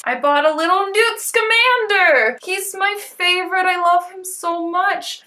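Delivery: high-pitched fangirl excitement; gushing delight. Real, not acted. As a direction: gush over a beloved favorite. Gushing over favorite character figure.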